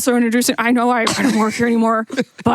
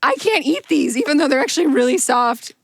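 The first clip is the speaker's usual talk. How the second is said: two bickering giant voices. Acted. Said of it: "doing both voices at once".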